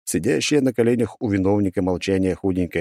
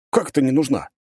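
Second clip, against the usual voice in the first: indignant; 6 semitones higher than usual. Acted. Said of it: "возмущается парень".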